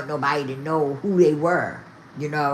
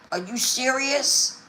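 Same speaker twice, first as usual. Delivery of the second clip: much higher, slow, stunned question; outraged disbelief; 9 semitones higher, slower than usual. Real, not acted. Vlogger reacts in stunned disbelief to the rope marks. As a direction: let the disbelief pull it up high and slow.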